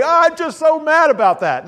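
high, loud pretend grumbling; hammed-up complaint of a disappointed sheep; mock upset. Voicing a sheep that would complain.

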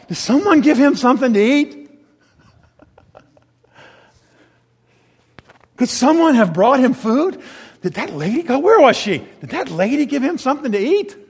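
high, loud, worried fretting; anxious disciples. Acted. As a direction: fret about who fed him. Disciples anxiously questioning each other.

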